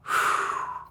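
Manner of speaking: a long unvoiced exhale; soft breathing-out sigh. Shown as a demonstration. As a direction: let the breath go audibly. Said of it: "a single breath".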